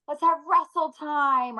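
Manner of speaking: high, slow, sing-song invitation; mock excited family pitch; playful kid-like suggestion. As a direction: pitch it up like rallying the kids to play. Mom voicing playful family suggestions.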